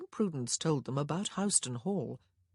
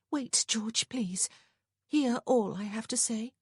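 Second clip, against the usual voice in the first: higher-pitched plea; begging; 7 semitones higher than usual. A narrator's reading. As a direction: plead to be heard out. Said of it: "Prudence begged him".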